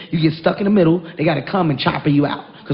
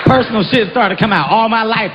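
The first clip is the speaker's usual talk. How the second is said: ordinary talk, nothing marked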